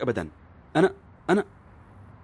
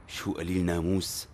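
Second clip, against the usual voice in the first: muttered aside; 9 semitones lower, 8 dB quieter, slower than usual. Acted.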